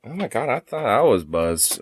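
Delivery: usual speaking voice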